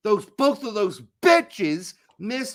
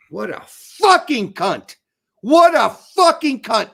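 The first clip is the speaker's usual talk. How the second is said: swearing louder and louder; angry rant; 2 semitones higher, 9 dB louder than usual. Real, not acted. Podcast host cursing a player, building to an angry yell. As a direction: spit the insult out, building to a yell.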